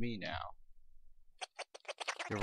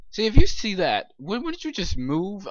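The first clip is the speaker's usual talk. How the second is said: startled; 8 semitones higher, 6 dB louder than usual. Real, not acted. Reacting to something under the bed.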